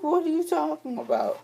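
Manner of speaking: very high squeaky disbelief; incredulous. Retold or imitated. To his calm mom, high incredulous squeak. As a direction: shriek it in disbelief.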